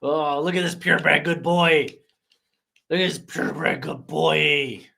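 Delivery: cooing dog-owner voice; pet talk. Real, not acted.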